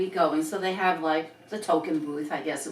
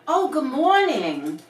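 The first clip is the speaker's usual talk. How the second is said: friendlier reply; 6 semitones higher, 4 dB louder than usual. Retold or imitated. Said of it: "it changed the whole tone".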